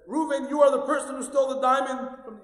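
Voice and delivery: loud, high accusing king; an outraged king shouting at the thief; angry king. Acted. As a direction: hurl the accusation at him.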